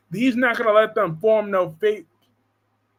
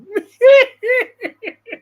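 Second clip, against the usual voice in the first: high laugh; 8 dB louder than usual. Real, not acted. Laughing at her own joke to the chat.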